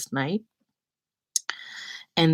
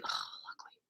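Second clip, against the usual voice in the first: soft unvoiced aside; whispered aside; 18 dB quieter, faster than usual. Real, not acted. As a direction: whisper it to yourself.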